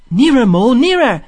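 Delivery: high, loud, pleading call; urgent calling. A narrator's reading. Rat calls Mole closer to the reeds, urgent. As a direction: call out before it fades away.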